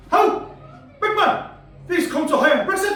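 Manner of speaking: loud, high-pitched Geordie shout; Geordie accent. Acted. Indian mate from Newcastle shouting to comedian, loud Geordie accent. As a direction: holler for help in a broad Newcastle accent. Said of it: "I'm gonna do the accent".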